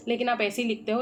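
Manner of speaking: usual speaking voice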